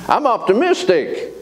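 a ringing high declaration; emphatic proclamation. Real, not acted. Preacher proclaiming his outlook, high and ringing. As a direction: sing out the word. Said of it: "preacher proclaiming".

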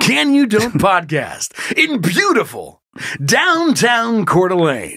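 slow, drawn-out show announcement; hammed-up announcer. Host announces the podcast and its town, hammed-up announcer. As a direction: stretch the words like a showman.

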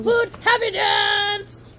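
slow, high, loud cheer; excited shout. Real, not acted. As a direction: belt it out like a party chant. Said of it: "Wanna throw in another happy dance?".

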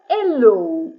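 hello with no H sound, starting straight on the e; French hello, silent H. Shown as a demonstration. A teacher showing students the silent H in hello. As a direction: drop the H in hello. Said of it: "Літера H не вимовляється ніколи".

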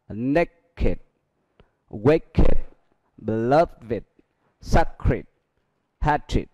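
"Beloved" and "Sacred" ending in id. Shown as a demonstration. Teacher drilling adjectives for the class, ed as id. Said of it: "E D được phát âm là it".

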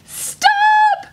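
very high, loud drawn-out cry; howl. A narrator's reading. Baboon swings in to stop the attack, howling. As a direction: howl it out to halt a fight. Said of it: "Baboon howled".

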